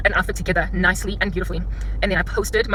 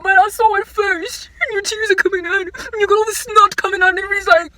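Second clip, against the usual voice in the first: mock tearful whine; 10 semitones higher, 5 dB louder than usual. Imitating a crying driver who lost a spot, mock sobbing. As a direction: blubber like a sore loser. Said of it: "while you sit there on some".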